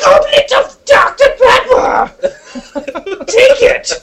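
very high, slow, near-crying shriek; shrieking Harry Potter impression. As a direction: wail the name like a hysterical fan. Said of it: "just like the Harry Potter bit".

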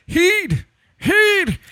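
very high, loud Scottish yelling dad; Scottish accent. Retold or imitated. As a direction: yell it like an angry Scottish dad. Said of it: "In a Scottish accent".

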